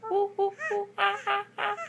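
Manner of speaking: put-on high monkey hoots for the baby; monkey hooting. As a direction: hoot it up high like a playful monkey. Mother imitates monkey for toddler.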